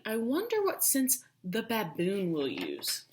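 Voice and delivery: plain reading voice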